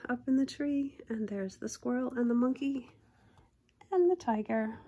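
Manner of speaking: lively storybook reading voice; reading a picture book to kids; story narration. A narrator's reading. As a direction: read it like a bedtime picture book.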